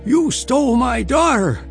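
high, loud accusing cry. Acted. Mother accusing the man who married her daughter, crying out. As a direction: cry it out in outrage, pitch leaping up. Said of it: "her mother cried out".

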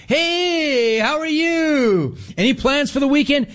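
high, slow singsong greeting; chirpy customer-service voice; chipper bank teller. Retold or imitated. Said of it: "they kept doing me like that".

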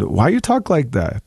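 lightly imitating a teasing old speaker; teasing elder. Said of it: "she actually made fun of me a little bit".

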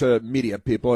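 ordinary delivery

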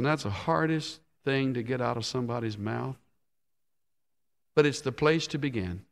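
ordinary talk

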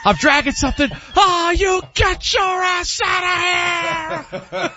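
very high mock yell, drawn out at the end; hammed-up dad meltdown; repeat dad-blowing-a-gasket impression. Son performs dad on request, hammed-up strained fury. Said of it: "Do it one more time".

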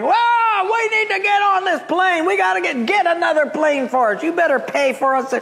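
high-pitched yelled demands of angry passengers; crowd yelling. Retold or imitated. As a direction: mimic a fuming mob at the airline desk. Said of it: "two hundred people back are yelling".

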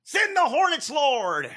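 hammed-up radio preacher imitation; mock preacher. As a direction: parody the preacher's plea, pitched high on hornets. Echoing the preacher clip.

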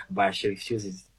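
ordinary talk

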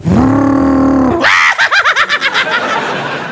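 loud cackling laugh of triumph; triumphant laugh. Retold or imitated. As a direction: crow with laughter. Cutting off the car, triumphant.

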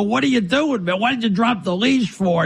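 retold yelling at wife. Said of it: "I'm yelling at my wife".

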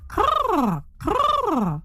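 high rolled crr, falling; raven cawing. A narrator's reading. Big raven flaps overhead, rolled cawing. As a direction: trill a high bird croak. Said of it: "A big raven".

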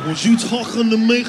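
hammed-up throaty noise voice; mock throat noise. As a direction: challenge someone through a put-on blocked throat. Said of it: "what the fuck that noise means".

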